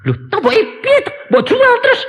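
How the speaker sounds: high, fast and loud son's voice; incredulous son. Retold or imitated. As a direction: rattle it off high in disbelief. Son reacts in disbelief.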